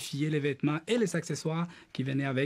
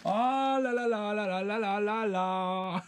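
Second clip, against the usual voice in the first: high giggling squeal, then a slow drawn-out oh là là; excited laughing squeal; 5 semitones higher, slower than usual. Real, not acted. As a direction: squeal with delight over a found treasure. Joyful discovery of Barbie outfits.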